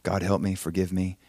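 low, soft plea to God; quiet, contrite retold prayer; humbled prayer. Husband asking God to forgive him, humbled and hushed. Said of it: "And so I humbled myself".

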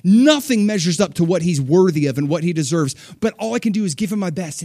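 rising, fervent pledge of devotion; passionate worshipper. Retold or imitated. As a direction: declare it like a devoted giver. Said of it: "He's like".

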